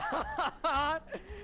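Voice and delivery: short, high startled laugh; real laugh of disbelief. Real, not acted. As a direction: let out a high surprised laugh. Player laughs after shock.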